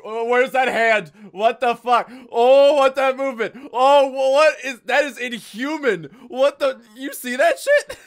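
high, loud disbelief; shocked outburst. Real, not acted. Streamer reacting to the creepy game scene, shocked. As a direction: recoil at something creepy.

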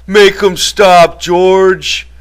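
high, loud, slow pleading cry; bleating terror. A narrator's reading. Lenny, being beaten, begs George, bleating terrified cry. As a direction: bleat it out, begging for rescue. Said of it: "bleated with terror. He cried".

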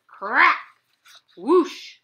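high, loud crack and whoosh; loud sound effects. Acted. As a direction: burst out the storm noises. Houses blown away.

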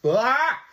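loud, high retold outburst; sudden scream. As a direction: burst out with a yell mid-sentence. Wife breaks off with an outburst.

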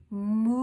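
a long, drawn-out cow moo; demo moo. Shown as a demonstration. Teacher showing children the cow sound, mooing. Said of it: "What sound does the cow make".

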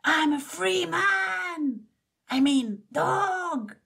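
high, slow gleeful yell; joyful cry. A narrator's reading. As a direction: whoop as if just set free. Boy-dog celebrates freedom.

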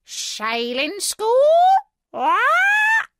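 high, slow parrot voice rising to a loud screech; squawking parrot. A narrator's reading. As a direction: squawk it loudly like a mocking parrot. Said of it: "squawked the parrot".

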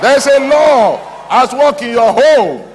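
high, booming proclamation; belted, far-carrying pulpit cry; full-force preaching shout. Real, not acted. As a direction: shout the promise over the crowd.

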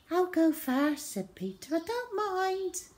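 put-on high-pitched little boy's voice; a hint of an obliging child; lightly voiced good little boy. Good brother volunteering for the injection, in a high boy's voice.

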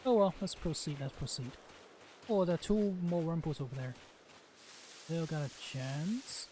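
ordinary talk

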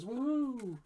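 high, gliding celebratory whoop; excited whoop. Real, not acted. Breaker who got something himself, excited whoop. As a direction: let out a high woohoo. Cheers getting a card himself.

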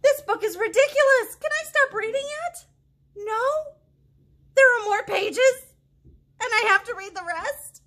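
high, loud, hammed-up protest; mock outrage. Reader begging the kids to let her stop, high mock outrage. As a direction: overplay the horror of having to keep reading.

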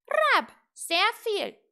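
very loud, high, slow squawk; calling raven. A narrator's reading. Raven retorting to little witch, slow loud cry. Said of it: "rief der Rabe".

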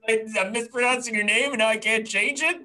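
hammed-up disbelief, rattling it off; mock exasperation. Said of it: "I'm like".

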